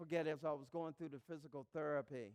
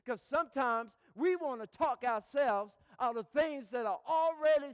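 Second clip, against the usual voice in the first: loud, rising preacher's build; preaching; 7 semitones higher, 9 dB louder than usual. Acted. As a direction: preach it to the crowd with full force. Preaching at full force.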